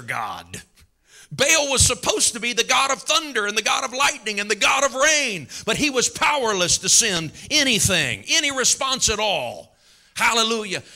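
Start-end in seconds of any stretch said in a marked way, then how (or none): 1.3-10.8 high, driving pulpit proclamation; full-force preaching. Real, not acted. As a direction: preach it out emphatically.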